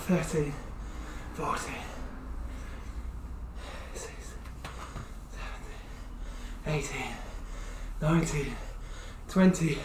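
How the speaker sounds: winded counting mid-squats; breathless counting during exercise; counting while squatting. Real, not acted. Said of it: "I have to count and talk".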